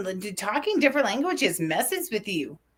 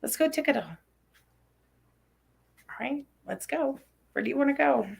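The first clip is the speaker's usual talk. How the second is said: put-on high, soft child's voice; small, high-pitched kid voice; Avelina; 6 semitones higher, 4 dB quieter, faster than usual. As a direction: imitate a little kid asking to go. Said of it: "even Avelina".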